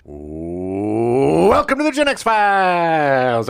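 loud, drawn-out swooping welcome; stretched-out host's greeting; big show intro. Real, not acted. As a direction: wind up slowly into a booming show opening.

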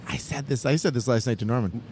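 ordinary talk

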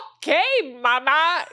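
put-on high, loud parody of Spanish-accented English; teasing Spanish-accent imitation; mocking mom's accent. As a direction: throw mom's Spanish accent back at her, bratty. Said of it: "I would make fun of her".

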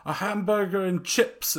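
high-pitched, hammed-up bad British accent; mock a very bad British accent; terrible British accent. Mimicking an actor ordering chips, terrible British accent. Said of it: "like, the worst British accent".

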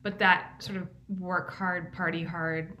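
usual speaking voice

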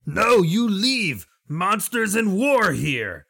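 slow, high, loud creature shout; high-pitched lizard warrior bellow; lizard folk yell. Acted. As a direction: yell in drawn-out broken warnings. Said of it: "the lizard will yell again".

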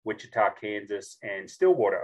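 normal talking voice

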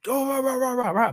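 high mock rant of noise; imitating an adult yelling rah rah. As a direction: rattle off angry nonsense. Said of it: "they would have been like".

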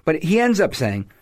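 ordinary talk

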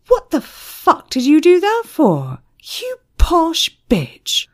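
high, loud indignant demand; angry outburst. A narrator's reading. Man confronting the woman who hurt his friend, angry outburst.

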